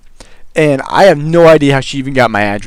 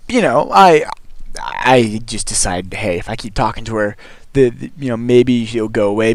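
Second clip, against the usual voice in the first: ordinary talk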